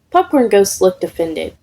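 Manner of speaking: plain reading voice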